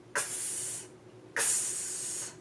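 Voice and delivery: "X" as an unvoiced "ks"; a k click running into a long hissed s on "X"; letter X sound. Shown as a demonstration. Teacher showing the sound X makes, voiceless "ks". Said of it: "X makes a X sound".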